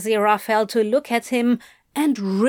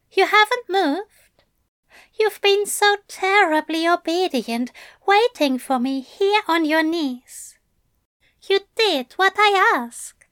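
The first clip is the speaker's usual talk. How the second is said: pitched-up gentle angel praising his demon; Aziraphale's voice; 9 semitones higher than usual. A narrator's reading. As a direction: purr the praise up high like a doting angel.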